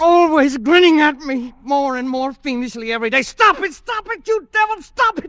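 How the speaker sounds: frenzied terrified raving; hysterical screaming. Acted. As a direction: scream at the fiend in terror. Screaming at the grinning vase.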